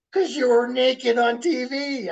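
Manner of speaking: put-on high, loud, whiny child; a lightly voiced upset kid; daughter's reply. Daughter telling dad why, in a high whine.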